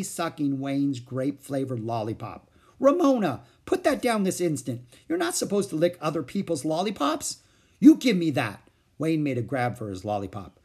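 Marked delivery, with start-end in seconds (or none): 2.8-7.4 scolding. A narrator's reading. Sister scolding Ramona. 7.8-8.7 demanding. A narrator's reading. Boy demands lollipop back.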